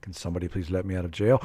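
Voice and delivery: ordinary talk, nothing marked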